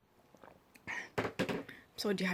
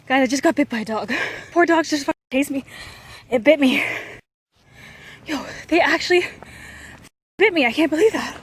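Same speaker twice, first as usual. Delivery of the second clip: loud, high, panicked outburst; real distress, voice raised; shaken and breathless; 5 semitones higher, 7 dB louder than usual. Real, not acted. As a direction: blurt it out in real shock, winded. Just bitten while fleeing dogs.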